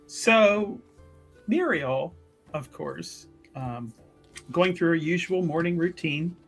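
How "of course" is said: ordinary talk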